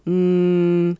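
closed-mouth hum through the nose; nasal hum. Shown as a demonstration. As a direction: hum with lips sealed. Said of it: "keep your mouth closed and go".

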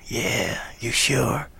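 soft, husky imitation. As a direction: keep it quiet and gruff. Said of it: "I remember him saying something like".

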